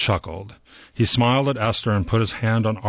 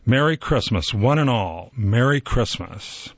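plain reading voice

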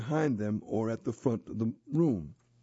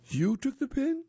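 pitched up high in surprise; surprised question; 13 semitones higher, 3 dB louder than usual. Acted. Missus Blake hearing the boy's confession, surprised. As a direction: catch her off guard. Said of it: "Missus Blake looked surprised".

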